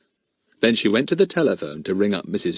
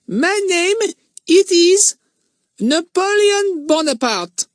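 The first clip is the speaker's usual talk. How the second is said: put-on slow, much higher French-accented boy's voice; 5 dB louder, slower than usual. A narrator's reading. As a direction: announce the grand name in a French accent. Odd French boy introduces himself.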